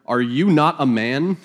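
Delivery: reading through real laughter; can't keep a straight face; laughing. Real, not acted. Preacher cracking up at David's taunt to Abner, laughing. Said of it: "I'm the only one laughing".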